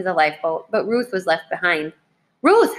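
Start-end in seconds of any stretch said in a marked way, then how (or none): 2.4-2.8 high, loud cry of a name; mother's urgent scream, kept within storytelling; narrated scream. A narrator's reading. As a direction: call out sharply in alarm. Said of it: "her mother screamed".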